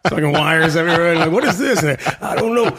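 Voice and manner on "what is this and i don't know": lightly voiced sitcom bickering, pitched up; higher-pitched mock sitcom voice; acted sitcom characters. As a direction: play a hint of an old sitcom character, higher than usual. Acting out Sanford and Son characters at home.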